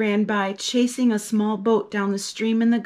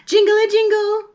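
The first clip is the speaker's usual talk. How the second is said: high, louder jingle; sing-song; 10 semitones higher, 6 dB louder than usual. A narrator's reading. Narrator imitating ice cream van music, high sing-song.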